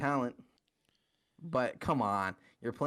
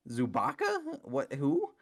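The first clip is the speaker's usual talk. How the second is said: much higher, incredulous squeak; mock confusion; slower than usual. Mocking the Clippers' lineup to fans, high incredulous squeak. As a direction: play dumb about the name, way up high.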